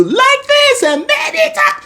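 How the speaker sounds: exaggerated high monotone demo; high-pitched talking. Shown as a demonstration. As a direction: jump up high and stay on one note. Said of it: "I can't be talking to you".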